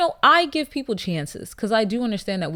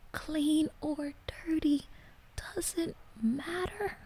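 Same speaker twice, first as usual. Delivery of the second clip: hushed, slow, much softer disbelieving whisper; 6 semitones higher, 13 dB quieter, much slower than usual. Shown as a demonstration. Rereading the letter's line to viewers, slow shocked whisper. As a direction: breathe the words out in shocked disbelief.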